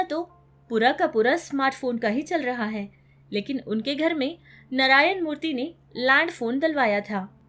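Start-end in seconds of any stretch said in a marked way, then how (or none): none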